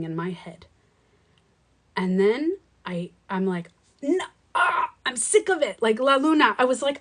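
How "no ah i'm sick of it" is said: high exasperated outburst, acted out; fed up. Woman recounts snapping at her spiraling thoughts, fed up. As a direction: groan out that you've had enough.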